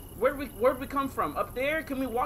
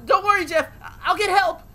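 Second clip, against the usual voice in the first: loud, high, urgent call for help; urgent shout; 8 semitones higher, 9 dB louder than usual. Pretend. Shouting to fallen Jeff, promising to get help, loud and urgent. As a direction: yell it like you're racing off to save a friend. Playing a rescue scene aloud.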